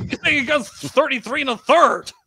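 mock bewilderment, drawn out; perplexed. Mister Mayo puzzled by the book's opinion, mock baffled. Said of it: "and he was like".

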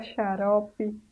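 ordinary delivery

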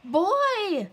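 high, loud yell; cry out. A narrator's reading. Little Wolf calling his parents, loud high yell. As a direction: yell to get them running. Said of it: "he cried".

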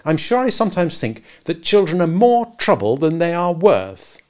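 high, loud weary mother; exasperated mother. A narrator's reading. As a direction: throw up your hands at them. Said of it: "cried Wendy".

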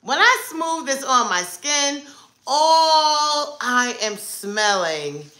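ordinary delivery

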